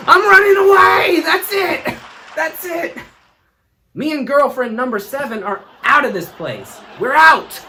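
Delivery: high frantic yell; panicked shout. Retold or imitated. Roger fleeing the polygraph, high frantic shout. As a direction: scream it and flee.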